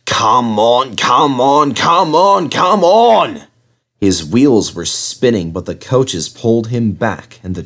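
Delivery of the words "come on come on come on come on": rising higher with each come on; building strained puffing chant; straining effort building. A narrator's reading. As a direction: push harder and harder up the hill. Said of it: "he puffed".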